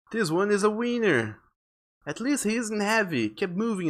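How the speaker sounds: put-on high, brisk creature-kid voice; winged kid captor. Winged kids carrying off a boy, high-pitched chatter. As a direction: pitch it well up, grumbling about the catch.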